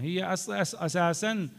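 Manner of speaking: ordinary talk, nothing marked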